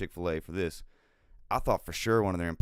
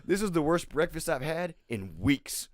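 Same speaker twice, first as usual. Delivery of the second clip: mock furious complaint; 8 semitones higher than usual. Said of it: "he was furious".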